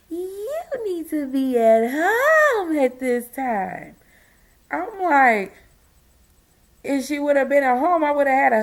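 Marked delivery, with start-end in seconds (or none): 0.1-4.0 fake cute. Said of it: "trying to make it sound like it was so cute".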